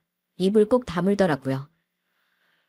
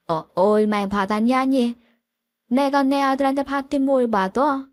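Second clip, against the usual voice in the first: flustered father-in-law; 6 semitones higher than usual. Acted. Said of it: "황홀한 목소리로 이러시더라고요". (당황해서 말을 늘이며 발뺌하는 시아버지 목소리)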